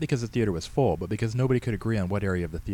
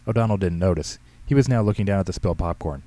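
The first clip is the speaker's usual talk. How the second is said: ordinary delivery, nothing marked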